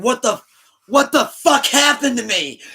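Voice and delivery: high, loud panicked yell. Retold or imitated. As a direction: yell it in shock at the mirror. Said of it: "He would look in the mirror and be like".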